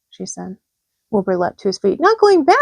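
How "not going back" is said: high, loud alarmed cry; high loud shocked pig cry; cried out. A narrator's reading. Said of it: "he cried".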